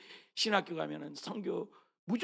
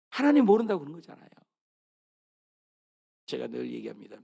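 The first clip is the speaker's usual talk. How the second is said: normal talking voice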